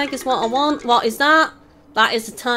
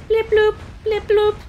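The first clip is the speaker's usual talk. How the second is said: high-pitched mock electronic bleeps; silly blip noises; 8 semitones higher than usual. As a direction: make playful little blips up high. Playful computer-like noises after teleportation.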